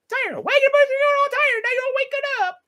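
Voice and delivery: high squeaky pet voice calling the dogs awake; baby talk. Acted. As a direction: coo to wake the dogs.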